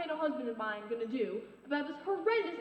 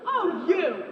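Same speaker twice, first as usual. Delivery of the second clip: loud indignant cry; outraged outburst; 3 semitones higher, 9 dB louder than usual. Acted. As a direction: burst out, scandalised. Neighbour insults her marigolds.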